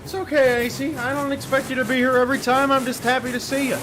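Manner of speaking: bright cheery encouragement; reassuring. Real, not acted. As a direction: coo reassurance at the scorer. Reassuring a chat scorer.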